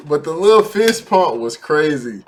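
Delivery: ordinary delivery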